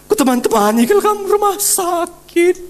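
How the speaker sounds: high and a bit loud boasting lament; imitating a mourner bragging at the coffin; mock eulogy. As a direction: ham up the showy mourner.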